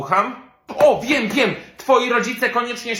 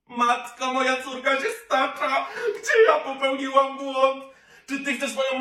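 higher, half-laughing fake sob; mock despair; 6 semitones higher than usual. Mother suspecting drugs, wailing in mock despair.

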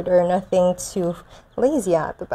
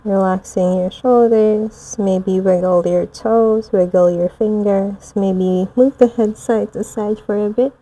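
soothing yoga teacher; 3 dB louder than usual. Acted. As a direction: ease them gently back into the body. Gentle return from meditation.

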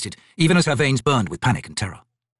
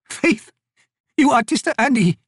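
put-on high, louder, scornful voice of an old woman; 13 semitones higher, 5 dB louder than usual. Old Maibi scorning Corlat's claim of faith, high and sneering.

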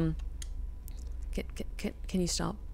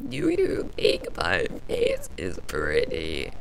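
creepy eight-bit voice; 11 semitones lower, slower than usual. Pretend. Creepy game-creature voice for the portrait.

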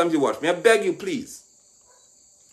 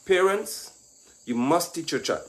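ordinary delivery

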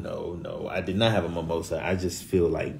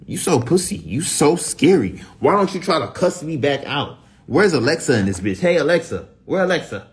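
high angry rant; 7 semitones higher, 4 dB louder than usual. Real, not acted. Cussing out Siri, shrill, high and loud.

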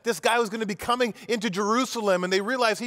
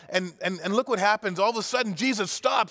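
ordinary talk, nothing marked

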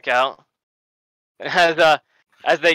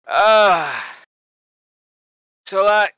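nervous character voice; 4 semitones higher, 6 dB louder, much slower than usual. Acted. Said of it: "And he goes".

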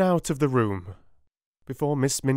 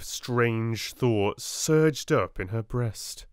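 ordinary delivery, nothing marked

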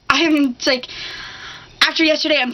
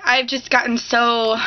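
normal talking voice

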